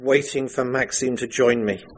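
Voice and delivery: ordinary talk, nothing marked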